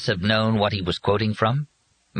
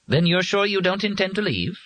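high, even robot voice; 12 semitones higher than usual. A narrator's reading. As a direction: speak like a polite machine.

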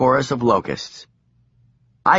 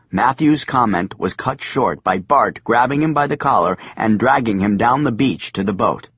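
usual speaking voice

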